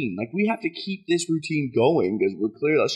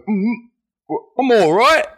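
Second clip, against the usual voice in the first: strained fake British accent, higher and louder; 8 semitones higher, 5 dB louder than usual. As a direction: force out a fake British accent while feeling sick. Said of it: "still trying to keep up with the accent".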